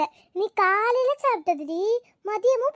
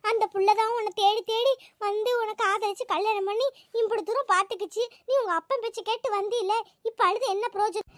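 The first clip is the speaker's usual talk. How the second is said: usual speaking voice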